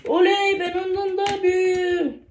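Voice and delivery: high delighted victory cheer; gleeful cheer. Real, not acted. Cheers at being older than car.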